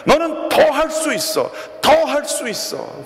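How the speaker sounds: very high, straining call, slowing at the end; coach shouting. Retold or imitated. Coach to exhausted student, very high straining shout. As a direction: pour everything into the encouragement. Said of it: "계속해서 소리를 칩니다".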